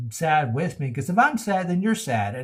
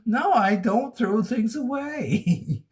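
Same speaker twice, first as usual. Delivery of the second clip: laughing; 7 semitones higher, slower than usual. Real, not acted. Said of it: "now I'm laughing".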